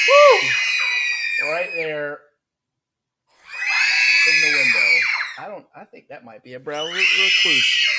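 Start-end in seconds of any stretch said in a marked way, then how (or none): 0.1-1.5 very high, loud startled whoop; loud nervous high whoop; high alarmed whoop. Real, not acted. Spider right next to him. 1.5-4.8 loud startled call, pointing it out; loud alarmed call about the spider; alarmed shout. Real, not acted. 6.6-7.9 loud, higher cry of alarm; loud higher alarmed exclamation; alarmed shout. Real, not acted. Fears a brown recluse.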